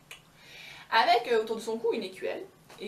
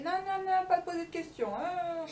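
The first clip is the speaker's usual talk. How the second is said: self-scolding; 10 semitones higher than usual. Acted. Blondine scolds herself.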